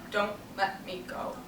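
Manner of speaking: put-on soft, low plea of the old; faint dying plea. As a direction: let the plea sink away, hushed and low. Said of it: "From the old sometimes cry out".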